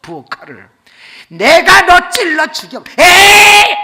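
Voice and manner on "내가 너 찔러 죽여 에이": much higher, louder furious threat and stab cry; murderous yell. Retold or imitated. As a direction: scream the threat as you lunge. Husband lunges with knife.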